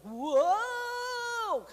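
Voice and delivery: loud, high wail of being swamped; hammed-up overwhelmed cry; mock overwhelmed cry. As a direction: let out a big stressed whoa.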